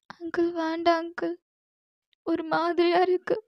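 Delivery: high, soft, trembling plea to let her go; frightened girl pleading. Acted. Captive girl begs Jimman to send her home, frightened pleading. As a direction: beg your captor like a scared young girl. Girl pushes his hands away.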